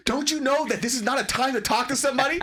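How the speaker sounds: high, loud, exasperated telling-off; scolding mom. Retold or imitated. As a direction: imitate mom scolding dad.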